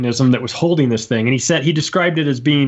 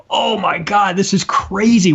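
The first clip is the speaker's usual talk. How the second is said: higher-pitched, reenacted astonished exclamation; retold amazement; 6 semitones higher, slower than usual. Listener recalling his reaction to the photographer's story, amazed. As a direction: act out your stunned reaction, pitch jumping up.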